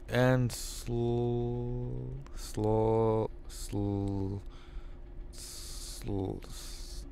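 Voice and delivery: stuck on sl-, trailing off to nothing; fading stammer. Acted. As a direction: let the word die away. Stalls on "sloth", fading out.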